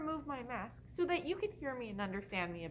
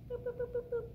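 playful boops; 9 semitones higher, faster than usual. Pretend. Playing with baby's toes.

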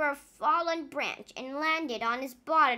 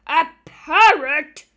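a slow, much louder incredulous roar; 15 dB louder, slower than usual. A narrator's reading. Captain Pepper scorning the parrot idea, roaring. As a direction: roar the word back in disbelief. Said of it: "he roared".

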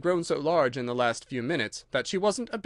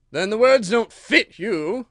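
slow, loud smiling king; smug smile; 7 semitones higher, 9 dB louder, slower than usual. Acted. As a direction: say it with a pleased smile. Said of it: "looking round the court with a smile".

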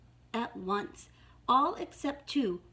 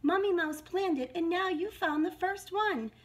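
high, eager mouse voice; lightly voiced baby mice, pitched up; squeaky baby mice; 8 semitones higher, 4 dB louder than usual. A narrator's reading. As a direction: pipe up like a tiny mouse. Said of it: "squeaked the baby mice".

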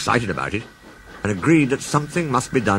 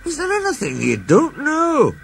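a much higher, amazed Scots voice; Scottish engine. Acted. Douglas asks the Fat Controller in amazement, in a high Scottish voice. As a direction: Douglas marvels at the boss.

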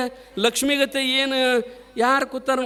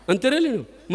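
usual speaking voice

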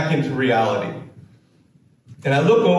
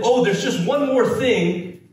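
mocking the professor; 8 semitones higher than usual. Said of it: "you said".

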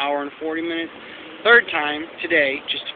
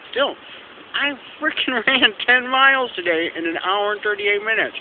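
proud, laughing burst of joy; bragging with a laugh; excited; 8 semitones higher, 3 dB louder than usual. Real, not acted. Proud boast after long run.

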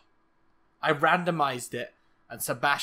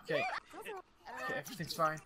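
usual speaking voice

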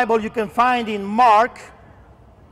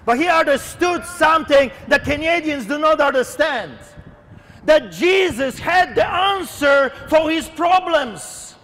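forceful warning, hammering the key words; fiery preaching; 5 semitones higher than usual. Real, not acted. Preacher warns listeners of coming judgment, fiery preaching. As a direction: preach judgment with long pauses between cries.

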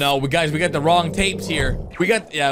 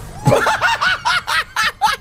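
burst of laughter. Real, not acted. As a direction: burst out in a high cackle.

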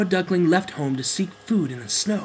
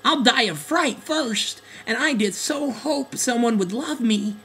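frightened duckling; 9 semitones higher than usual. Acted. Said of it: "moaned a now terrified duckling".

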